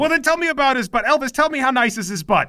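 mother barking orders at the team; bossy mother. Acted. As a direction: order everyone around like an imperious mom. Mother needling Elvis.

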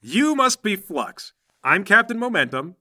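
high, slow, loud heroic hello; proud superhero welcoming the new recruit; superhero greeting. Acted. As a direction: boom it out like a caped hero on TV. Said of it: "and he goes".

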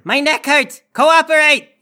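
high, loud bad-cop outburst; crazed shouting. Crazy cop intimidating a netted suspect, crazed shouting. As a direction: shout it like the unhinged partner. Playing the crazy partner in interrogation.

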